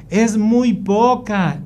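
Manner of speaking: put-on high, drawn-out kid's complaint; complaining child. Son complaining there is too little, high slow protest. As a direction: stretch it out like a picky child.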